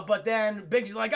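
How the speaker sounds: ordinary talk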